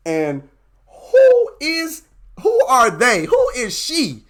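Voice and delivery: rising, incredulous mock shop owner growing into a shout; suspicious shop owner. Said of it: "The first thing they gonna wonder is".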